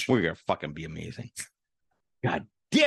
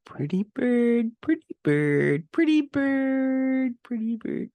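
high squawky parrot, slow; 3 dB louder, much slower than usual. Pretend. Parrot voice, asked to repeat.

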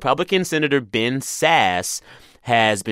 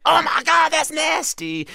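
disgusted high shriek. Retold or imitated. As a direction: shriek it like you're totally grossed out. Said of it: "Everyone is like".

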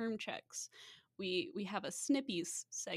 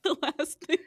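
laughing; 5 semitones higher, 9 dB louder, faster than usual. Real, not acted. Laughing through reenactment.

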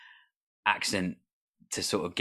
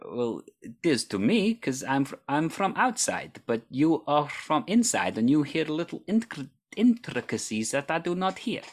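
high-pitched Russian accent attempt; imitation Russian accent; 7 semitones higher than usual. Shown as a demonstration. Host answers guest in her own accent, imitation Russian accent. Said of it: "that was my attempt of, uh, a similar accent to yours".

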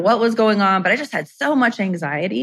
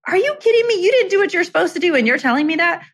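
much higher, outraged disbelief; incredulous retort; 10 semitones higher, faster than usual. Retold or imitated. Retorting to the bookkeeper who told her to own it, outraged disbelief. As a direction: fire back, can't believe what she hears.

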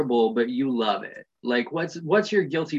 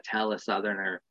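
ordinary talk

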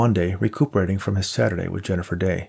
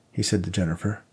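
ordinary delivery, nothing marked

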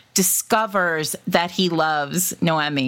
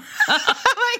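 high laugh. Real, not acted. Laughing at her own bit.

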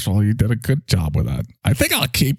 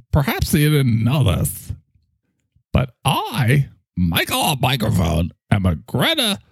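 usual speaking voice

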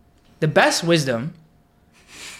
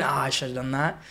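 regretful; 4 semitones lower, 7 dB quieter than usual. Shown as a demonstration. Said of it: "it's always in a tone of regret".

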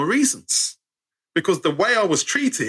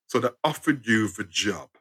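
dad repeating; 8 semitones lower, 4 dB quieter than usual. Retold or imitated. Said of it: "my dad's going".

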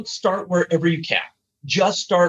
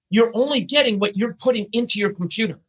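usual speaking voice

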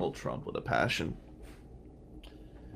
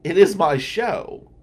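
slow, louder "it is my show"; mock insistence; 7 semitones higher, 10 dB louder, slower than usual. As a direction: lean hard on it like a proud host. Arguing over the podcast.

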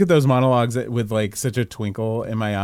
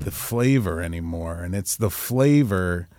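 lower, softer and slow grumble; deep grumbly voice; 4 semitones lower, 4 dB quieter than usual. Retold or imitated. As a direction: drag it out like a brooding monologue. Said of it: "someone with a deep, grumbly voice goes".

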